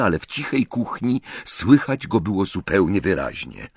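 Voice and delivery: usual speaking voice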